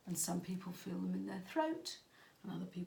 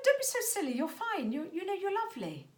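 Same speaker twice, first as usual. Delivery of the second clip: brushing it off, high and loud; dismissive mum; 11 semitones higher, 5 dB louder than usual. Retold or imitated. Mum dismissing daughter's body worries, high brushing-off tone. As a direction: mum waving away her daughter's worries. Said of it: "And I tell her".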